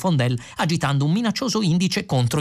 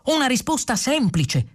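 high-pitched insistence; pitched-up, hopeful prompting; eager clarification; 10 semitones higher than usual. A narrator's reading. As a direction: add it eagerly in a raised voice. Eager programmer adds to the question.

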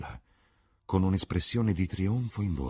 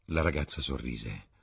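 ordinary delivery, nothing marked

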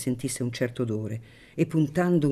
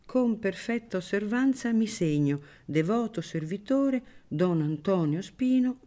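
usual speaking voice

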